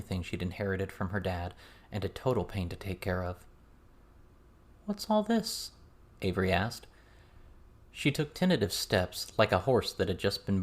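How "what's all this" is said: bewildered. A narrator's reading. Avery bewildered by the sight.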